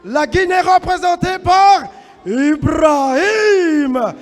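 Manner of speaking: booming, drawn-out fighter introductions; boxing ring announcer. Acted. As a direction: announce each fighter to the crowd.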